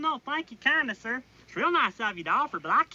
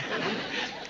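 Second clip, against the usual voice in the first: giggle; 6 dB quieter than usual. Real, not acted. Private laughing at being yelled at.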